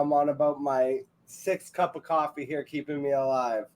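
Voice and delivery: usual speaking voice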